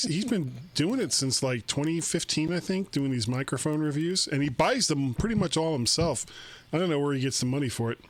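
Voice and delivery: ordinary delivery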